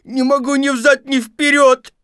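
very high, loud howl for help; desperate wail. Acted. Pooh stuck both ways, wailing desperately. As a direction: wail at full force in despair. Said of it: "завопил отчаянным голосом".

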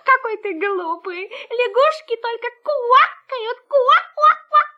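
frog voice. Acted. Laughing frog mocks puppy.

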